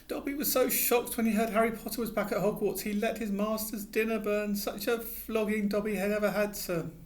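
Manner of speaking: high squeaky elf voice, anxious; a fretful little elf begging; pleading house elf. A narrator's reading. Dobby rocking, distressed.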